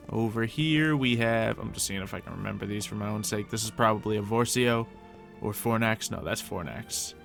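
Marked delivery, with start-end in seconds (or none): none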